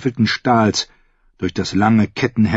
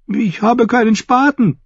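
high-pitched fool's protest; whining jester. A narrator's reading. The fool objects to digging a grave, high whine.

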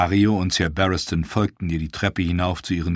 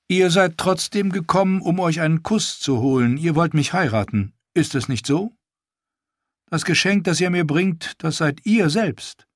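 put-on higher, teasing young queen's voice; lightly higher regal queen, playful; queen Daenerys; 8 semitones higher than usual. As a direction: tease the prince, then turn to business.

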